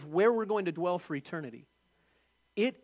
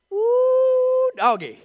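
much higher and louder yelp, then a laugh; preacher whoops with delight at Jesus' promise, loud and high; exultant whoop; 12 dB louder, much slower than usual. Real, not acted. Exclamation after Jesus quote.

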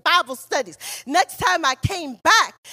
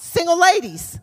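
ordinary talk, nothing marked

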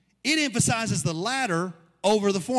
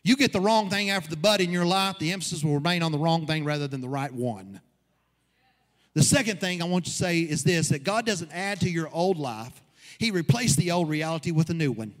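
preaching at full force; 2 semitones higher, 4 dB louder than usual. Acted. Pastor preaches to his church, driving the point home. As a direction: build the congregation up with every line.

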